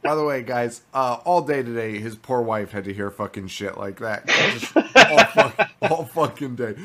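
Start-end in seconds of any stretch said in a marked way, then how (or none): 4.3-6.8 words breaking up into real laughter; laughing. Real, not acted. Teasing Paul about his poor wife, laughing. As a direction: crack up while saying it, pitched higher.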